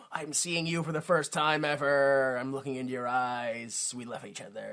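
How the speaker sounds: gaze-struck lover, hushed and fading; mock romantic. Lovers meeting on deck, soft mock romance.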